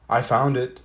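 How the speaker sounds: Froggy yelling that he found it; yelled. A narrator's reading. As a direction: shout it out, proud of the find. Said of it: "he yelled".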